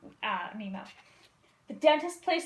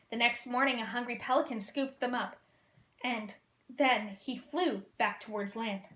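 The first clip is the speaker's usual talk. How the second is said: plain reading voice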